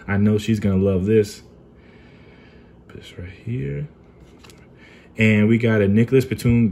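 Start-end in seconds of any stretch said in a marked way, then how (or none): 2.9-3.9 hushed secret whisper; breathy whisper so nobody hears. Real, not acted. Hiding card from wife, whispering.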